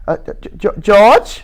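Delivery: hesitant, stammering start; shy faltering call; a timid stammer. Acted. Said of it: "looked timidly".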